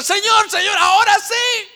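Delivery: high, loud pleading shout; desperate cry. Acted. Believer crying out to the Lord, desperate loud shout.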